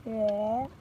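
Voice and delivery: high, squawking chicken "wah". Retold or imitated. Imitating the chicken he heard squawk nearby, squawky "wah". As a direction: squawk it out like a startled hen. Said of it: "Almost sounded like a chicken going".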